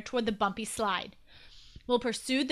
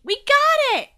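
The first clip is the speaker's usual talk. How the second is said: high, loud victory cry; triumphant yell; 11 dB louder than usual. A narrator's reading. Peter with the nose trapped, yelling triumphantly. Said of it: "he yelled triumphantly".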